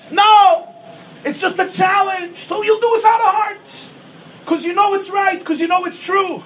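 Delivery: high, crying-out exhortation; preaching at full force. Acted. Rabbi urging listeners not to quit, crying out. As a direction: plead with the crowd.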